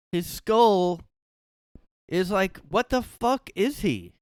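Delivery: high, loud, stretched "what is he"; real incredulous exclamation; disbelieving outburst. Real, not acted. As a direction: gawp at the photo in loud disbelief.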